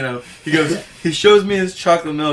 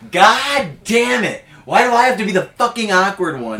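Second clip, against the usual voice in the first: loud, higher-pitched retold outburst; yelled frustration; 5 semitones higher, 4 dB louder than usual. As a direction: yell it out, pissed off and aggrieved. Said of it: "He yelled that as he walked".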